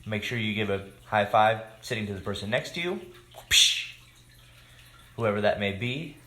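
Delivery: ordinary delivery, nothing marked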